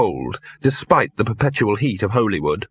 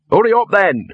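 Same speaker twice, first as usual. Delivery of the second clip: higher, louder call back; 9 semitones higher, 5 dB louder than usual. Acted. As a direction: call back to someone lagging behind. Dog calls back to Victor.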